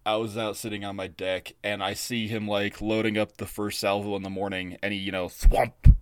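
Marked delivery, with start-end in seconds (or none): 5.3-6.0 imitated thwomp. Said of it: "a thwomp sound when he launches it".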